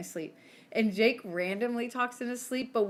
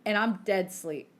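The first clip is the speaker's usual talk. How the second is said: ordinary talk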